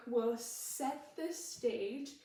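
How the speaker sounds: slow, stretched-out demo line. Shown as a demonstration. Choreographer cueing Bagheera's line, slowly drawn out. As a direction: stretch each word, lingering on the last. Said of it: "you say it a little slower".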